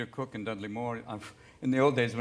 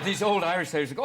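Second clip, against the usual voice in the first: ordinary delivery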